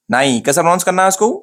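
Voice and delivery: ordinary talk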